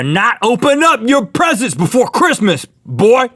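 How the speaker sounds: high, drawn-out mock maternal warning; scolding mama. Mother warning her twelve-year-old son, mock scolding. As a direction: voice a hammed-up mama laying down the law.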